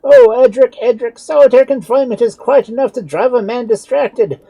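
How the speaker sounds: very high, loud desperate pleading; despairing plea. A narrator's reading. Doctor begging Edric to speak, despairing. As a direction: plead against the silence. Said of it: "quite in despair".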